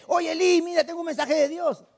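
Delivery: imitating an eager messenger, pitched high; mock eagerness. Samuel supposedly running to tell Eli, mock-eager and high. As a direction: act out running up with happy news. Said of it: "fuera corriendo a decirle".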